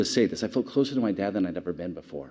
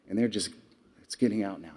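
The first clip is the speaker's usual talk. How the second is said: ordinary talk, nothing marked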